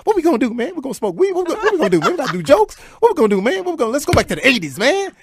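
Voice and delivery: high, loud, gushing rush of questions; over-the-top excited fan. Retold or imitated. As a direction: babble at him, too excited.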